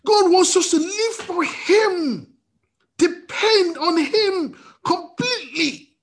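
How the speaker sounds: preacher proclaiming to the congregation; full-force preaching. Acted.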